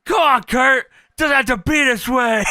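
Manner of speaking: Steiner, pleading. Retold or imitated. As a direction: call him down like a worried teammate. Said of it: "Steiner chimes in".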